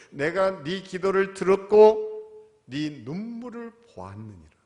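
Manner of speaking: slow loud proclamation fading to a hush; booming divine answer. Shown as a demonstration. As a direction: declare it slowly like God speaking, then drop. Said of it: "그렇게 반응 안 하셨어요".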